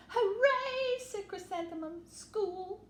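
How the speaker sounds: high, loud cheer; joyful cheer. Acted. Little mouse cheering as she runs to school. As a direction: burst out with glee.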